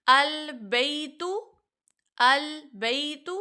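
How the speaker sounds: البیت said slowly, sounding each letter; slow demonstration. Shown as a demonstration. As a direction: model البیت slowly twice. (البیت آہستہ پڑھنا)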